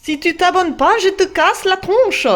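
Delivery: playful tough-guy threat; mock threat. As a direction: threaten them as a joke.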